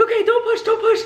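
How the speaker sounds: high, loud repeated warning; urgent call. Retold or imitated. Staff telling the mother to stop pushing, loud and urgent.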